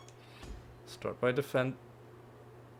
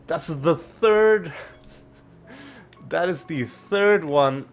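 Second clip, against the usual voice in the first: fuming; 5 semitones higher, 3 dB louder, slower than usual. Real, not acted. Wasted key, fuming.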